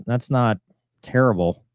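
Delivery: ordinary talk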